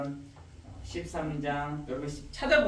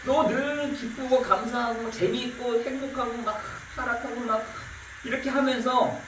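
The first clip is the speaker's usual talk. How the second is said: higher, louder challenging voice of God; 6 semitones higher, 4 dB louder than usual. Acted. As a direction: press them like God asking. Preacher voices God challenging people.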